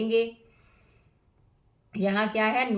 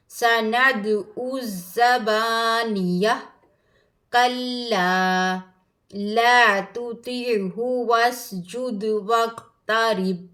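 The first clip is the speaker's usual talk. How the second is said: slow, drawn-out melodic recitation; Quran recitation; 4 dB louder, much slower than usual. Acted. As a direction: chant each verse with tajweed. (धीमी क़ुरआन तिलावत)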